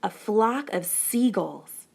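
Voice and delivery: plain reading voice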